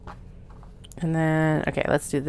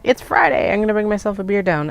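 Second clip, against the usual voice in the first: cheerful; 5 semitones higher, 6 dB louder, faster than usual. Retold or imitated. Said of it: "It's like".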